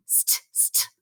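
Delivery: "St, st" hissed without voice; whispered blend. Shown as a demonstration. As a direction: breathe out "St, st" with no voice. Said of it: "stop begins with the blend".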